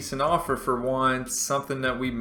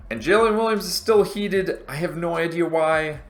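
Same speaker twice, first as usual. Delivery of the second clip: much higher, louder, fed-up complaint; exasperated; 7 semitones higher, 6 dB louder than usual. Real, not acted. Streamer complains the unhappy player is still upset, exasperated. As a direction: throw your hands up at him.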